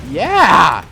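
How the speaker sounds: loud triumphant yell; excited cheer. Real, not acted. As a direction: celebrate the kill out loud. Cheering a kill in game.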